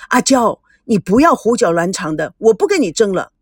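plain reading voice

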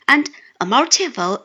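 normal talking voice

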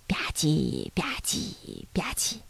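soft, hushed slurping noises; lip-smacking imitation. A narrator's reading. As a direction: make quiet little eating sounds. Narrator imitates animals eating soup. (小声模仿吧唧嘴)

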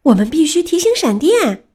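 high, urgent shout; crying out. A narrator's reading. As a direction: yell it in alarm. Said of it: "板牙大叫".

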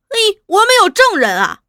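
high, loud indignant comeback; heated retort. Acted. Interrogator answers demand for evidence, high and heated. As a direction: fire back at the challenge, pitched high.

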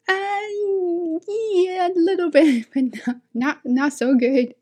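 high, hesitant playful downplaying; coy modesty. Teacher answering amazed students, coy and high-pitched. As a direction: play it bashful, drawing out the admission.

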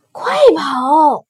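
high, loud cry to run; urgent shout. Acted. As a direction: yell the order with a stretched vowel. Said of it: "他们齐声喊道". (急切地高喊)

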